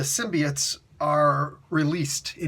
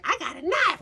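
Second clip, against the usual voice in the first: Nick Fury; 12 semitones higher than usual. Acted. Acts out Nick Fury's line.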